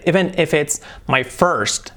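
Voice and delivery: slow "Even if it's my first" with a strong R in "first". Shown as a demonstration. Teacher slows down to show the strong R. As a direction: linger on "first", hit the R. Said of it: "la R fuerte".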